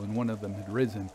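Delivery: usual speaking voice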